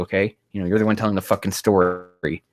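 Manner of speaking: usual speaking voice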